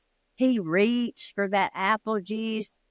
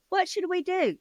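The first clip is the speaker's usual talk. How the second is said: high anxious question; panicked huddle; 11 semitones higher than usual. Acted. Family in a huddle, high scared question.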